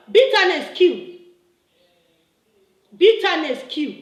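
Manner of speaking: loud, forceful admonition; emphatic warning. Real, not acted. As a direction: drive the warning home loudly. Emphatic warning, repeated to opponent.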